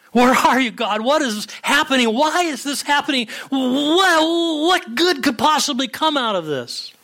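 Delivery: high, anguished questioning; crying out. Acted. Sufferer cries out to God, high anguished plea. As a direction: plead upward, stretching the last question. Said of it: "you're crying out".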